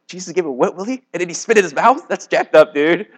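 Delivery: high, loud incredulous reaction; amused mock disbelief. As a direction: react like you can't believe what you just read. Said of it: "I always laugh".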